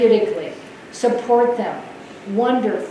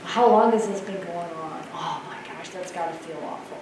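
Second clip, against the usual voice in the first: soft, lower, sympathetic HR voice; 4 semitones lower, 8 dB quieter than usual. Shown as a demonstration. HR leader showing how she soothes an upset employee, softly. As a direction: soothe them softly, like a caring agent. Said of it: "in my H R voice and say".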